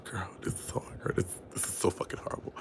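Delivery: unvoiced giggly whisper. Real, not acted. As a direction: whisper it like a guilty secret. Giggling embarrassed aside to viewers.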